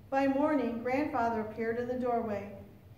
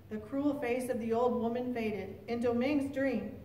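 usual speaking voice

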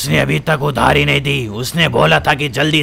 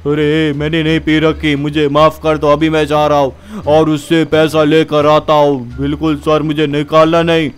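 slurred, sorry-sounding henchman; drunk goon pleading. Acted. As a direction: grovel to the angry boss. Undertaker denies drinking. (डरे हुए नशेड़ी गुंडे की गिड़गिड़ाहट)

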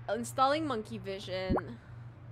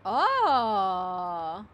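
loud sudden gasp of shock; startled cry; 3 semitones lower, 8 dB louder than usual. Real, not acted. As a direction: yelp in surprise.

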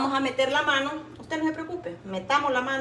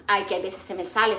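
usual speaking voice

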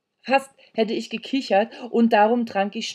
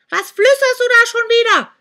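put-on much higher, louder witch voice of an old woman; shrieking old hag; 13 dB louder than usual. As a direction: screech at the kids like a deaf old crone. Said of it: "schrie die Alte ihn an". (kreischende alte Hexe)

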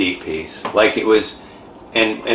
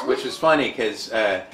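ordinary delivery

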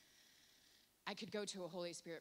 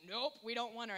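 mock dismissive God; 6 semitones higher, 11 dB louder than usual. God rejecting her, joke.

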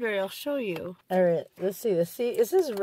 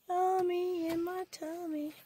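singsong; 9 semitones higher, 6 dB quieter, slower than usual. Acted. Playful singsong about food.

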